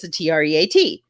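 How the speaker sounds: T R E A T spelled letter by letter. Shown as a demonstration. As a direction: spell out T R E A T, one letter at a time. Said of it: "I don't wanna say the word".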